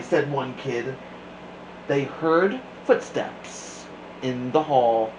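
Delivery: plain reading voice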